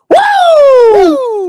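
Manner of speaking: long, high, loud "woo"; high-pitched hollering whoop; excited whoop. Real, not acted. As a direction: let out a piercing, drawn-out whoop. Guest whoops on joining live.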